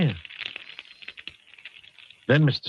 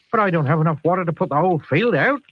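worried West Country farmer, a touch louder; a fretting Southwest farmer pleading; 5 semitones higher, 4 dB louder, faster than usual. Acted. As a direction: West Country accent, anxious. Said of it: "I feel like he's from the Southwest".

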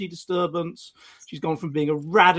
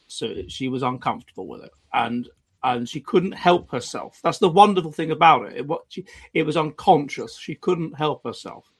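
normal talking voice

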